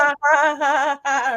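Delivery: high, loud imitated cartoon laugh; SpongeBob laugh. Co-host demonstrating SpongeBob's laugh for the others, high and loud. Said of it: "trying to remember what SpongeBob's laugh even sounds like".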